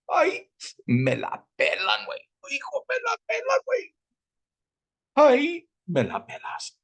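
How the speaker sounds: squeaky high mocking taunt; mocking squeal. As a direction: toss the insult up in a shrill falsetto. Mocking Spanish catchphrase.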